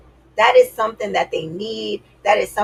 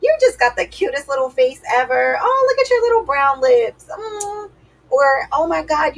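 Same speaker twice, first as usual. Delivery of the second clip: much higher, gushing sweet voice; mom flattering dad; 7 semitones higher than usual. Retold or imitated. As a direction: gush over him like an adoring wife. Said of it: "all she ever did was be like".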